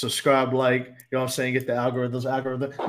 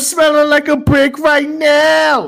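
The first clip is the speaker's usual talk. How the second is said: slow, high drawn-out yell; 14 semitones higher, 10 dB louder, much slower than usual. Acted. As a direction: bellow it out for no reason. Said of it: "I be yelling that shit for no reason".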